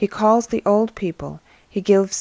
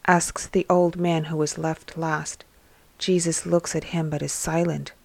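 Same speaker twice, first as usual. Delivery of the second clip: plain reading voice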